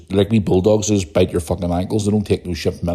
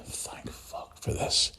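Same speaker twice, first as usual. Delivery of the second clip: whispered relief; 14 dB quieter, much slower than usual. Retold or imitated. Relieved inner thought, whispered.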